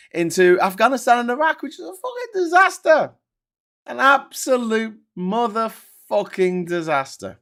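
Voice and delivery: high, loud, emphatic exasperation; outraged rant. Real, not acted. Condemning Afghanistan and Iraq, high outraged shouting.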